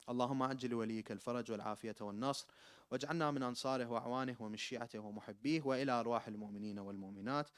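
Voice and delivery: fast, murmured Arabic supplication; rapid closing prayer. Acted. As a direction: rattle off the closing dua.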